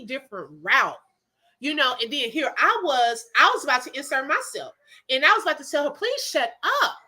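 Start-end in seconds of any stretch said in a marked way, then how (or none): 5.9-7.0 tempted retort. Retold or imitated. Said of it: "that's what I, I literally, it was on the tip of my tongue".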